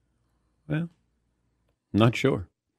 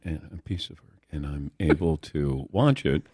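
much softer, lowered voice; quiet; 8 semitones lower, 7 dB quieter than usual. Real, not acted. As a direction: drop your voice right down. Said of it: "Lower down".

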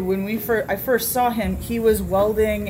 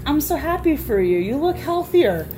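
high, loud, gladly encouraging reply; cheerful praise; 8 semitones higher, 4 dB louder than usual. Retold or imitated. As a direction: re-enact delight for the kid, pitched way up. Retold cheerful reply to the kid.

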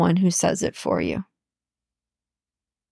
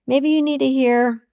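ordinary talk, nothing marked

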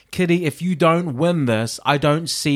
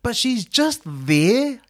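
slow and dry, a bit higher; deadpan dismissive; 5 semitones higher than usual. Real, not acted. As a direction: shrug her off, slow. Mocking Ella's blandness.

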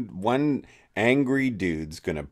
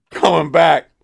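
mock smug sign-off; 4 semitones higher, 6 dB louder than usual. Mock reviewer signing off.

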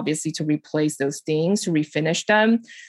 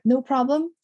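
imitating a super calm mover, pitched up; lightly voiced, higher, unbothered reply; easygoing calm mover; 7 semitones higher than usual. Movers reassuring the stager on job day, imitated as calm. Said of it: "are super calm and just be like".

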